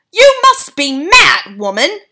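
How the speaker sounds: loud, high, slow outburst; enraged father shouting at the old woman; crying out. A narrator's reading. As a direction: fling it at her. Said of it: "cried Mister Jenkins".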